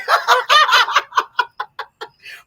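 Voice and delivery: loud laugh that climbs high. Real, not acted. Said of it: "Y'all see how that voice went up".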